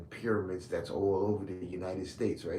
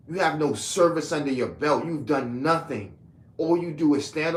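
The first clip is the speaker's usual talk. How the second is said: loud scornful list; 4 semitones higher, 6 dB louder than usual. Real, not acted. Berating his critics one flaw at a time, loud and scornful. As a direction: hammer home every failing like a verdict. Listing critics' failings, heated rant.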